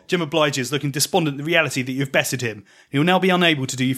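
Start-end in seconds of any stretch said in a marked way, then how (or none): none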